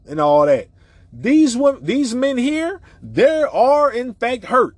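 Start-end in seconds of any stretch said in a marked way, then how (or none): 1.1-4.7 pitched way up, insisting hard; worked-up preacher insisting men are hurt; heated rant. Real, not acted. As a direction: push the point home, fired up.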